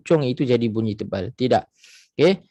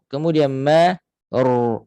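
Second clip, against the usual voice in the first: ordinary talk, nothing marked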